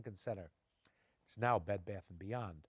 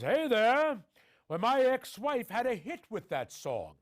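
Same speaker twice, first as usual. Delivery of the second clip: much higher, louder and slower, a booming grand showman; Jose Ferrer voice; 10 semitones higher, 8 dB louder, slower than usual. Retold or imitated. Director Jose Ferrer answering the nervous young actor from the corner, in a grand, booming imitation. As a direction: imitate the director's theatrical voice as he calls out. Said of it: "with this voice, a voice".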